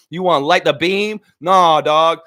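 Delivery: loud mocking refusal; loud trash talk. Taunting Kings fans, loud mock refusal. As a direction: shout it down at the Kings.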